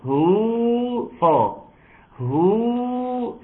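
recited long هو; long damma. Shown as a demonstration. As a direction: hold هو high like a recited madd. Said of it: "فهيبقى صعب".

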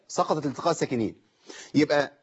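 ordinary talk

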